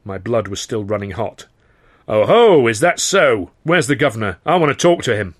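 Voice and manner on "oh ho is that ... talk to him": loud, hot-tempered demand; angry. A narrator's reading. To the boys, demanding to see the governor, loud and angry. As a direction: blood running hot, loud and indignant. Narrator hot-blooded, confronting.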